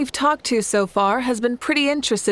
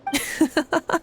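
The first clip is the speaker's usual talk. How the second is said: knowing chuckle; 6 semitones higher than usual. Real, not acted. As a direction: laugh like you saw it coming. Rit en devinant sa pensée.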